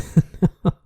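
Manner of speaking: high, bursting laugh of disbelief; a laugh of pure disbelief; exasperated laugh. Real, not acted. Yet another failed roll.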